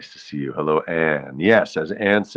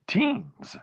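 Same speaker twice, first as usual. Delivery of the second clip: voice cracking; 14 semitones higher, 5 dB quieter than usual. Real, not acted. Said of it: "my voice cracked on the word teens".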